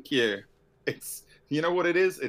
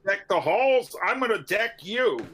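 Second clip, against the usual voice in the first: [name] improv; 7 semitones higher, 3 dB louder than usual. Acted. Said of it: "A little [name] improv there".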